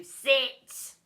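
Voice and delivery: loud barked command; order. A narrator's reading. Teacher ordering child to sit, loud command. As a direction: snap the order. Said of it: "ordered to".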